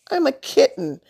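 high little kitten call; small mewing voice. A narrator's reading. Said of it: "called in a small mewing voice".

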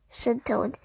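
ordinary delivery

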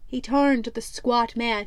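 ordinary delivery

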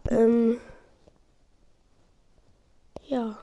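usual speaking voice

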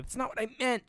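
mock angry. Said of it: "She gets all angry".

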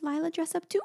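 put-on high little-girl voice; toddler voice. Mother imitating Lila asking her to dress up. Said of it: "And she goes".

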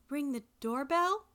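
high, slow echoed question; puzzled question. A narrator's reading. Granddaughter questions Grandma's odd instruction, puzzled. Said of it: "I asked".

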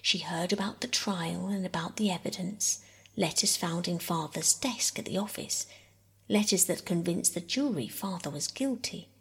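ordinary delivery

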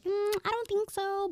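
high-pitched doubtful little voice; mimicked skeptic. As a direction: imitate doubters in a high, put-on voice.

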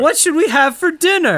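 high, swooning damsel voice; hammy stage actor. Acted. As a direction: overplay a delighted damsel. Damsel greets her knight.

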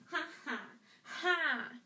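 high, mocking staccato laugh; crowing poltergeist cackle; gloating imp laugh. A narrator's reading. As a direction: laugh in his face, ending loud. Peeves laughs at Filch.

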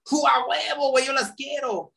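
high, gleeful mock excitement; a shopper mockingly craving quick fixes; mock eager. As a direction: gush like a bargain hunter.

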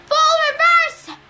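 screamed high and loud over the roar, slowly; screamed order. A narrator's reading. Captain screaming at the crew over the whirlpool, loud. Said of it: "Clarice screamed above the noise".

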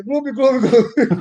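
high bubbly gloob gloob, laughing; fish gurgling noise. Retold or imitated. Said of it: "quem atendeu foi um peixe".

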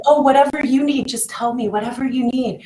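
a mild mock eager helper; eager people pleaser. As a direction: imitate your old self rushing to please. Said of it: "So I was going".

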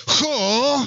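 put-on high, drawn-out Spanish kid's "¡Jo!"; queja de niño español. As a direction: imitate a child in Spain whining. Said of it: "Miren, les voy a decir". (quejido agudo de niño de España)

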